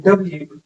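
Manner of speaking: डब्ल्यू said as "dub-lu", short and falling; clear demo of W. Shown as a demonstration. Teacher showing students the right way to say it. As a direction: clip डब्ल्यू into two syllables, "dub-lu". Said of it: "इसका सही pronunciation क्या होता है".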